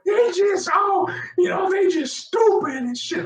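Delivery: very high-pitched imitation of a yelling woman; mock irate shriek. Irate woman ordering police to clear people out, mock shrieking. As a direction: yell it high and drawn, sending her up.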